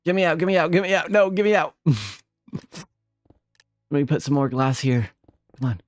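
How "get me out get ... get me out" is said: mock panic. Real, not acted. Streamer watching her build go wrong, higher mock panic. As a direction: fret that the build is ruined, pitched up.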